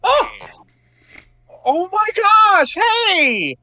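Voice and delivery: high, slow, delighted oh my gosh; a father's overjoyed surprise at hearing his kid; surprised happy father exclaiming. Acted. Fred surprised, delighted by daughter.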